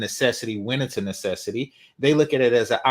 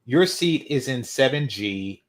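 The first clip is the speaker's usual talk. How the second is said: usual speaking voice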